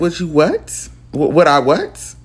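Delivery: loud, stunned double take; retold shock, rising on WHAT; incredulous. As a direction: stammer it back in disbelief. Retold shock at his question.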